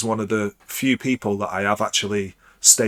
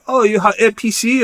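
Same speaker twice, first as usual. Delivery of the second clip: high-pitched, excited mock surprise; excited visitor; 11 semitones higher, 4 dB louder than usual. Visitors reacting to an offered performance, retold with excitement. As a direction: imitate an eager guest's question, pitched way up.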